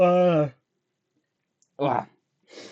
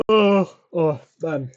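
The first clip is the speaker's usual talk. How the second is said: words stretched through a big yawn; 11 semitones higher, 14 dB louder, much slower than usual. Real, not acted. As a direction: talk mid-yawn, mouth wide open. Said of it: "yawning a lot".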